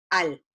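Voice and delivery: teacher voicing the inverse syllable al. Shown as a demonstration. A clear teaching demo of al. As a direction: say al as one clear syllable, vowel then consonant. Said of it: "primero vocal y después consonante".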